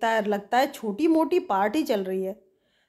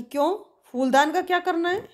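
mildly panicky, pitched-up question; flustered; 8 semitones higher than usual. Acted. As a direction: ask with a touch of alarm. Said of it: "cocoa फिर घबरा जाता है". (थोड़ा घबराकर, ऊँचे सुर में)

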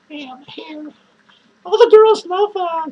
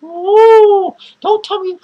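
ordinary talk, nothing marked

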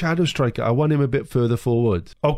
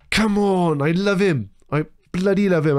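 raised, jubilant pitch; elated gushing; 5 semitones higher than usual. Real, not acted. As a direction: declare your love like a thrilled fan. Gushing over his goalscorer.

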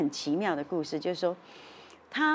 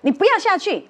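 sudden fierce shout, much higher and louder; 凶狠喝止; 9 semitones higher, 7 dB louder than usual. Retold or imitated. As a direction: snap at him to stay in the car. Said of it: "他就突然很凶说". (突然很凶地大声喝止)

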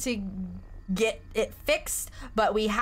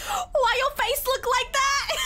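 high laughing disbelief, slow; 14 semitones higher than usual. Real, not acted. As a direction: ask it through laughter. Mocking a character's weird face.

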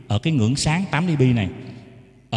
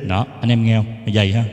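ordinary talk

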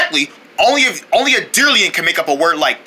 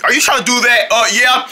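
ordinary delivery

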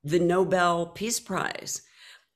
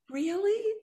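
tiny doubtful question, much higher; hopeful disbelief; a hushed, high "Really?"; small, high, wondering "Really?". Retold or imitated. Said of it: "And I was like".

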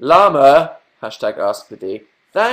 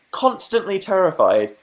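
higher, slower, hammed-up fear; mock terrified; 8 semitones higher, 4 dB louder than usual. Joking about the scary hairdresser, hammed-up terror. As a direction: stretch out the fear for laughs.

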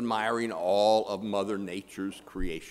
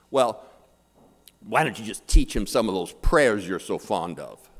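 louder, snarky jab; 7 dB louder than usual. Acted. Atheist giving up on God's help, snarky retort. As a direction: throw it back with attitude. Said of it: "in kind of a snarky attitude".

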